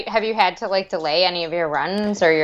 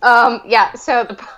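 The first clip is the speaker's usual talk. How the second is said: ordinary delivery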